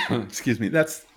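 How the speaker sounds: coughing after water went down wrong; sputtering through a cough; choking on a drink. Real, not acted. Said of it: "I'm coughing because I just took a drink of water that went down the wrong way".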